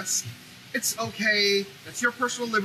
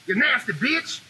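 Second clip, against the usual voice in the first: mock insult; slower than usual. Kid insult ending the taunt.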